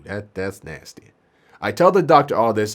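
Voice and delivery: usual speaking voice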